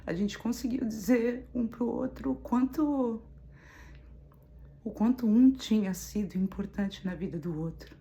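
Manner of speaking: real welling-up, pushing through the pauses; choked up. Real, not acted. Woman recalls telling an old love his importance, choked up.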